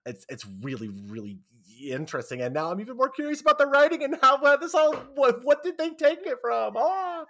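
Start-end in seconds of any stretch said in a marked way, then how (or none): 2.4-7.2 very high, loud, racing squeal; overexcited gushing. Real, not acted. Fan geeks out over the writing, squealing excitement. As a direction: gush faster and higher until it bursts.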